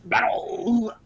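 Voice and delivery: slow, booming TV announcer. Acted. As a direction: hype it like a show host. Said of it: "become the American Ninja Warrior announcers".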